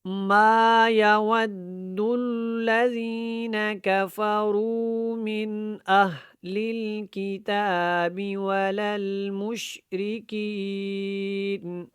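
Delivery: stretched, careful tajweed chanting; proper tajweed recitation. Shown as a demonstration. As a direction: recite with full elongations and held nasals. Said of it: "اچھے طریقے سے پڑھنے کی کوشش".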